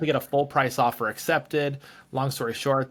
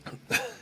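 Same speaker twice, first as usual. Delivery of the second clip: coughing fit; 6 dB quieter than usual. Real, not acted. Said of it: "Sorry, I'm choking".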